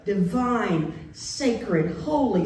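usual speaking voice

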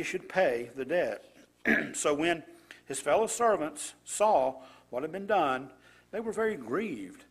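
scripture reading. Acted. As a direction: read the passage out to the congregation with weight. Reads scripture passage aloud.

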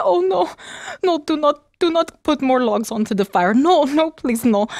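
put-on frightened girl begging the maid; pleading girl. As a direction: plead with her not to stoke the fire.

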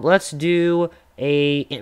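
normal talking voice

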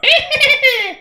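very high, squeaky cackle; gnome chuckle. Acted. Gnome deck wizard doing her maneuver, high chuckle. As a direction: giggle like a tiny gnome wizard. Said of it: "same sort of chuckle".

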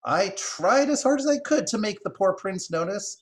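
high-pitched, insistent squirrel voice; earnest squirrel. Acted. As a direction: plead that you did your best, high-pitched. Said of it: "holding both front paws to her heart in affirmation".

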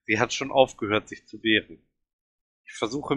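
usual speaking voice

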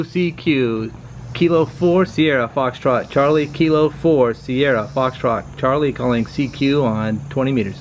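ordinary talk

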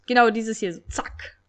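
usual speaking voice

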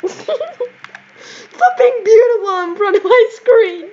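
high, drawn-out protest breaking from laughter; playful complaint. Pretend.

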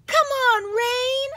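loud high joyful cheer. A narrator's reading. Girl cheering for the rain, loud and high. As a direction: shout it with delight. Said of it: "I cheer".

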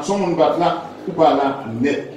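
ordinary talk, nothing marked

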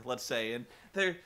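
usual speaking voice

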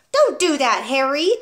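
loud, high protest; cried out. A narrator's reading. Wife protesting as husband turns down the TV, loud. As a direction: yelp at him to stop. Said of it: "Missus Wormwood cried out".

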